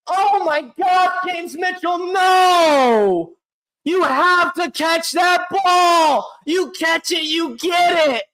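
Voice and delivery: high, slow, exasperated yelling; frustrated shouting. Real, not acted. Fan berating James Mitchell over the drop, frustrated shouting. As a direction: hammer home that he had to catch it.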